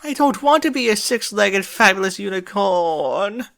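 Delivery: high, loud, hammed-up lament; melodramatic wail. A narrator's reading. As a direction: wail for full dramatic effect. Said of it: "Rarity wailed".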